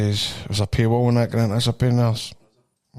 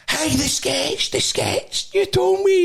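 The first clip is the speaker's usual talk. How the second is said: much higher, squeaky imitation of the young attendant; excited petrol guy; slower than usual. Teller imitating the petrol station boy, high and eager. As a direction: play the starstruck kid at the till.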